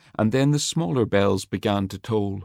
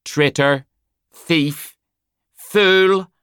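rising, louder mocking jeers; jeering insults; 8 semitones higher, 6 dB louder, much slower than usual. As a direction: hurl each insult higher than the last.

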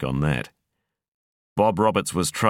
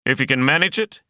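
high, raised shout over the wind; yelling; 10 semitones higher, 4 dB louder, faster than usual. A narrator's reading. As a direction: call it out loudly over the roar. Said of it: "he yelled".

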